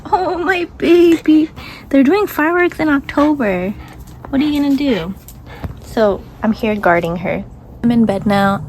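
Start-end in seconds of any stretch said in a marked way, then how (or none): 0.1-5.1 cooing at a scared pet; baby talk to dog. Acted. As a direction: coo to the dog.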